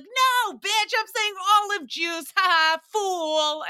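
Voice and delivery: loud, high jeering shout; mocking taunt. Seventh-grade crush springs his prank on her, mocking. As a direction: crow the insult, drawing out the last word.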